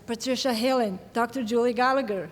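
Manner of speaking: usual speaking voice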